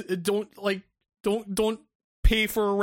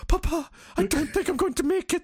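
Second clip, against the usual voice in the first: hammed-up feeble son's voice, pitched high; mock dying son; 10 semitones higher than usual. Dying son gasping to his papa after the car. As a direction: overplay a child dying in his father's arms.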